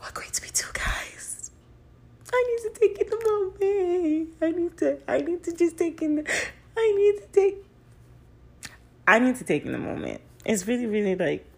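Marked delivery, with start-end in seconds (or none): 0.0-1.5 soft unvoiced whisper. Real, not acted. Savoring the anniversary news. 2.3-7.6 much higher, squeaky overwhelmed voice; squeaky giddy voice. Real, not acted. Overwhelmed with joy.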